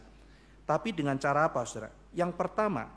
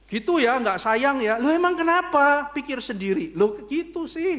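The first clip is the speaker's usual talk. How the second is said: sulky quarrel; 8 semitones higher, 6 dB louder than usual. Acted. Husband and wife squabbling, sulky and loud. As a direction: act out a petulant squabble.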